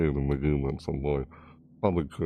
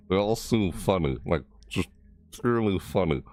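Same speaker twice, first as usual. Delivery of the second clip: ordinary talk, nothing marked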